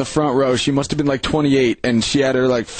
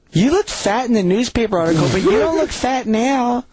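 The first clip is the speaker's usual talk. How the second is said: imitating her voice; 7 semitones higher than usual. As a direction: mimic the dumb girl sweetly. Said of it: "he's imitating her voice perfectly".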